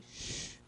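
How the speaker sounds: "sh" held alone as a soft unvoiced hiss; isolated SH sound. Shown as a demonstration. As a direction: demonstrate "sh" as one single sound. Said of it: "no que eu falei". ("sh" sozinho, soprado e prolongado)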